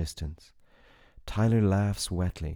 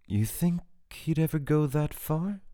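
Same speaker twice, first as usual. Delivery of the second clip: 1 lightly voiced woman; 8 semitones higher than usual. A narrator's reading. Character asks about Janklov.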